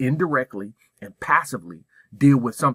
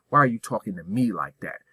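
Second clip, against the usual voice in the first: ordinary delivery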